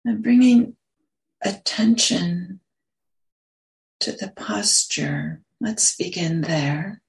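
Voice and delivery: unhurried meditation guidance with long pauses; guided meditation. Real, not acted. Meditation teacher settling listeners into posture and breath, guided meditation. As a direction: ease them gently into stillness, pausing between phrases.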